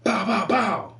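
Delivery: sudden high, loud alarmed cop with mock gunshots; startled cop. Cop retold startled by approaching dog, loud alarmed yelp. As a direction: yelp it like a panicking cop, then fire. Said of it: "the cop was like".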